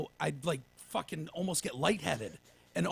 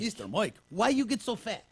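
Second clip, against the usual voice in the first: imitating Zofia; 5 semitones higher than usual. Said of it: "Remember when she said that?".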